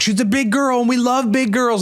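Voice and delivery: high-pitched imitation of cheering fans; mock gushing. Comedian mimics people praising a big singer, mock gushing. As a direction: gush like an adoring fan, put on.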